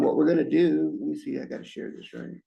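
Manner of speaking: usual speaking voice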